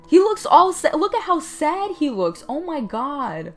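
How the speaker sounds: high-pitched sympathetic wail; high, overcome exclamation; distressed outburst. Real, not acted. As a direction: cry out in exaggerated pity. Exclaims over sad-looking character.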